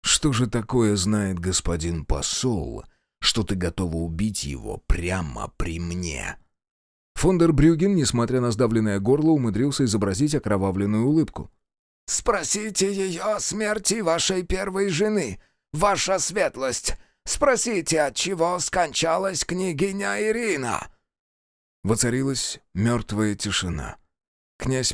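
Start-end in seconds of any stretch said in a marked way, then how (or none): 0.0-6.4 slow, low, quietly menacing question; cold, suspicious prince. A narrator's reading. Prince questions his wife's motive, coldly suspicious. 12.1-20.9 high-pitched, strained envoy voice; strangled envoy. A narrator's reading. Envoy pinned to the wall bargains, strained.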